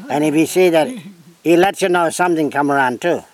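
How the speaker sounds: normal talking voice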